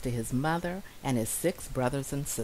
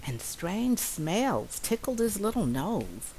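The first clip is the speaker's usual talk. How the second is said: plain reading voice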